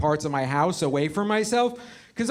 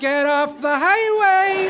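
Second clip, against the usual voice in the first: put-on high, long drawn-out drunken yell; hammed-up insistent holler, pitched way up; drunk friend; 11 semitones higher, 5 dB louder, much slower than usual. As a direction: bellow it slowly, stretching the last word. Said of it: "Waze is your drunk friend".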